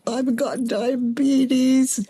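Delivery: slow, drooping mock-tragic sigh of the words; slow half-hammed woe; mock gloomy lament. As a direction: drag it out like a heavy burden. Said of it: "It's like".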